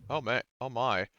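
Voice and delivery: ordinary talk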